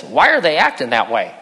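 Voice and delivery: lightly voiced young rabbit asking; puzzled little rabbit, lightly done; Thumper. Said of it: "Thumper says".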